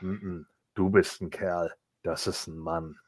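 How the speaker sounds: lightly voiced low tough guy; a hint of a deep movie tough guy; deep Batista voice. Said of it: "Batista von der Seite und sagt".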